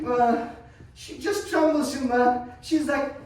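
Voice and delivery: normal talking voice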